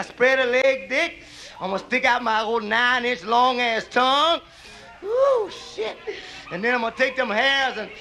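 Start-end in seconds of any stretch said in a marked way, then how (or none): 5.0-6.0 high squealed ooh, shit; pleasured high moan. Acted. Pimp relishing his plan to Dick, high ecstatic squeal.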